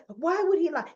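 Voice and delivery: loud, high-pitched frantic question; frazzled. Acted. Ashley protesting about the waiter, acted frazzled. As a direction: throw out the question, acting flustered. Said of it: "Now she's getting frazzled".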